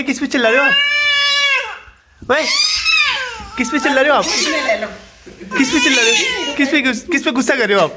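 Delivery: high, sing-song talk to a dog; pet talk. Real, not acted. Vlogger asks the barking dog why it yells, high pet voice. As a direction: gently chide the dog up high.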